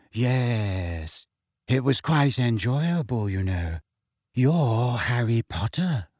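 slow, dreamy faraway voice. A narrator's reading. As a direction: vague and unhurried, miles away. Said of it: "said Luna dreamily".

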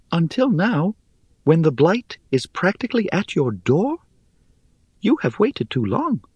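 high, airy, scornful little voice; airy spider voice. Acted. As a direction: chide them, light and dry.

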